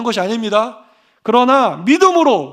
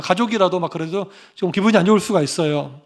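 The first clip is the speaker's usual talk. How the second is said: ordinary talk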